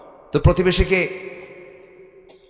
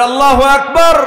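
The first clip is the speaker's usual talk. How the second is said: very high, very loud rallying cry; all-out congregational roar, pitched right up; full-voiced praise shout; 13 semitones higher, 11 dB louder than usual. Real, not acted. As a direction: push the crowd to its loudest. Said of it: "আরো জোরে".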